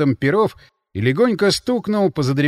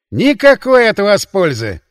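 loud, slow dismissive jibe; scornful snort; 6 semitones higher, 9 dB louder, slower than usual. A narrator's reading. Counterintelligence man dismisses the sorcerer's help, scornfully. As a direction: raise the voice in scorn. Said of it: "фыркнул контрразведчик".

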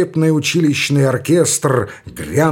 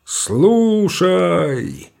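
higher, very slow, ringing; sharp metallic command; 5 semitones higher than usual. Acted. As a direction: cut the air like a parade colonel. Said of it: "резким металлическим голосом".

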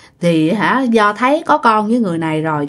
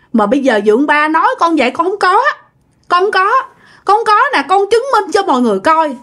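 higher-pitched, insistent pleading, no real sobbing; retold tearful protest; 8 semitones higher, faster than usual. Wronged youth protests to stepfather before the neighbours, high-pitched retold pleading. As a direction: plead your innocence in a raised retold voice. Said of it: "anh Quang khóc rất là nhiều".

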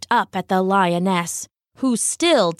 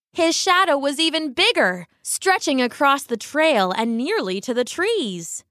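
plain reading voice

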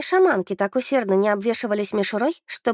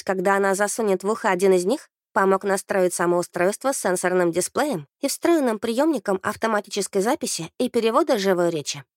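ordinary delivery

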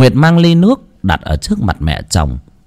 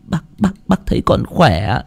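tearful; 8 semitones higher than usual. Acted. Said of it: "nước mắt lưng tròng".

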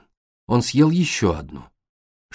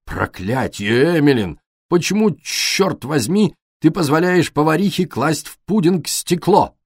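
angry roar; 6 semitones higher, 3 dB louder than usual. A narrator's reading. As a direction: bellow in indignation at the table. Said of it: "и проревел".

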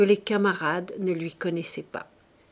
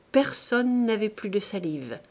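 ordinary delivery, nothing marked

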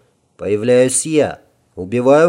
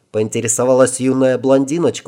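ordinary delivery, nothing marked

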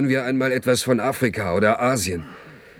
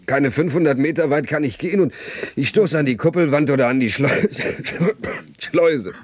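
higher, rising exasperation with a sour laugh; bitter outburst; 6 semitones higher than usual. Acted. Man rants to friends about the dome walls, bitterly. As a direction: vent the feeling of being caged.

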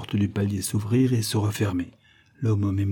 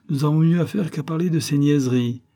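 impatient interruption; 6 semitones higher, 3 dB louder than usual. A narrator's reading. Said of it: "interrompit Antoine".

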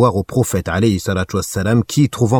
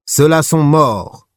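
higher, louder and slow; taunting proclamation; 7 semitones higher, 4 dB louder, slower than usual. A narrator's reading. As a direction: call it out slowly in triumph. Abu Sufyan proclaims to Muslims. (proclamation lente, plus haute et plus forte)

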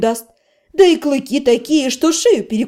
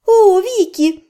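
laughing greeting; 6 semitones higher, slower than usual. A narrator's reading. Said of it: "Всё ещё смеясь отозвалась".

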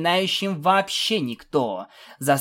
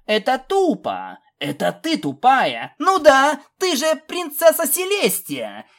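high, heated filly voice getting louder; indignant; 9 semitones higher, 5 dB louder than usual. A narrator's reading. Filly calls out the princess's silly pretence, indignant. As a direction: argue back, building in pitch and volume.

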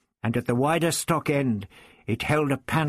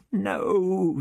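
a high frightened moan; Vicky moans as the Zarbi seizes her; 8 semitones higher than usual. A narrator's reading. Vicky pleading as the Zarbi closes in, a frightened moan. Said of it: "she moaned".

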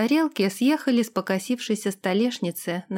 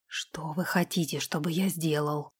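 hoarse, whispered and much softer; husky whisper; 5 semitones lower, 7 dB quieter than usual. Acted. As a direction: breathe the question huskily. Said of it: "хрипло спросил он". (хриплый шёпот)

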